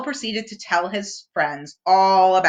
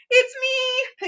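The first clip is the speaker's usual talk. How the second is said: Piglet voice. A narrator's reading.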